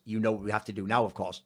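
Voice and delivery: low, grave villain delivery; ominous movie line. Pretend. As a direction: say it like a sinister mastermind. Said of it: "someone comes in and goes".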